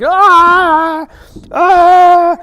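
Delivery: high, loud mock groan of pain. Shown as a demonstration. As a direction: ham up the hurt brother's moans. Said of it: "lay on the ground like you're hurting".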